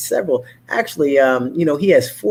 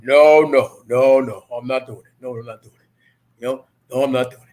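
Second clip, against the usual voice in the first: deeper dad voice; 3 dB louder than usual. Retold or imitated. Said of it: "he says it in a deeper voice".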